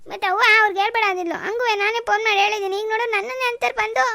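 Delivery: crying loudly. Acted. Daughter cries loudly to her father about her mother-in-law's blame. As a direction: blurt it out between sobs. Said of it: "ಕಣ್ಣೀರು ಒರ್ಸ್ಕೊ".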